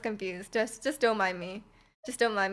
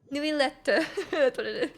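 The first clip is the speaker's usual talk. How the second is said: French accent; 3 semitones higher than usual. Shown as a demonstration. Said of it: "That's my amazing French accent".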